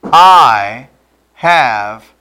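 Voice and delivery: I have sinned, each word spaced and slow; slow, drawn-out confession. Shown as a demonstration. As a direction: lay it out word by word, slowly. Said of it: "Say it with me".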